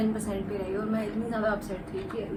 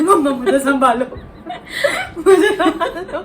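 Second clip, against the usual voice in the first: louder, higher, laughing through the plea; laughing panic; 5 semitones higher, 8 dB louder than usual. Retold or imitated. Daughter begging mom to hide her, laughing hard. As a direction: laugh as you beg mom to rescue you.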